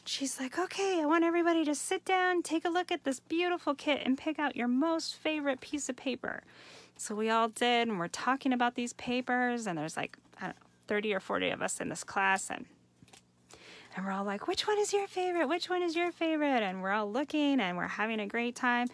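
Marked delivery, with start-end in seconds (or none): 0.6-6.4 imitating the teacher. Craft teacher rallying her class, imitated high and eager. 14.5-16.6 high, quick chatter of excited classmates; giddy students. Retold or imitated. Students ask each other their favorites, high and giddy.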